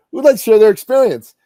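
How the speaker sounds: loud, high call to the room. Acted. Host asking workshop audience to share, calling out. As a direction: throw the question out to a crowd.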